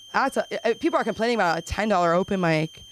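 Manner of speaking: normal talking voice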